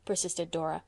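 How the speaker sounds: plain reading voice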